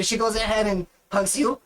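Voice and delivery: normal talking voice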